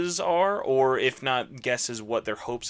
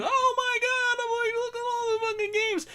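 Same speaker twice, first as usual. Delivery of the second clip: mock excited squeal. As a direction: squeal it like an overexcited fan. Excited fan exclamation quoted.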